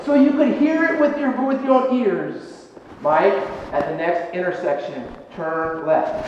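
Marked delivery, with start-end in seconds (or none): 3.0-6.3 imagined voice of God. Pretend. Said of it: "preferably audible".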